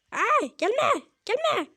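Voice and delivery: high, urgent character cry; high-pitched "stay away" alarm; panicked plea. Acted. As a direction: beg them off in a high voice. Game character cries out under attack.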